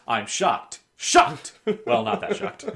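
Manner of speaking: from shocked to a high, loud outburst, then backing off; mock shock. Quoting a meme to the co-host, mock shock. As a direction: build mock outrage to a peak on the second word. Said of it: "the meme right under it is".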